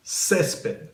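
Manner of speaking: césped with a high CÉS, falling after; stress on first syllable. Shown as a demonstration. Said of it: "So the emphasis goes here".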